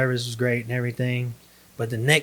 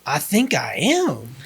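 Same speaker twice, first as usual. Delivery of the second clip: slow, higher-pitched mock realisation; comic retold admission; 4 semitones higher, 3 dB louder, slower than usual. Husband admits to wife he is manifesting, comically sheepish. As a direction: draw out the confession for laughs.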